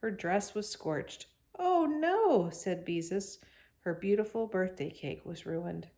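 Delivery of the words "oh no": dismayed. A narrator's reading. Cake ruined.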